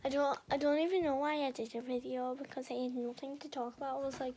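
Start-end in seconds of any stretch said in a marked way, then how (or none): none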